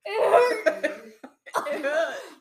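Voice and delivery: high, loud laughing fit; burst of laughter. Real, not acted.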